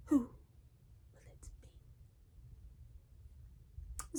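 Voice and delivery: slow, drawn-out theatrical whisper; hushed dramatic echo. Pretend. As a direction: echo the question in a suspenseful stage whisper. Dramatic echo of own question.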